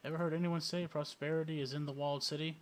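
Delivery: usual speaking voice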